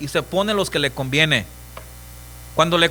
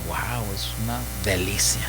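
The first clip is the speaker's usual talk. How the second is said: low, soft, savoring "es una delicia"; mock delight; 8 semitones lower, 7 dB quieter than usual. The worldly eye savors the rot, low and hushed.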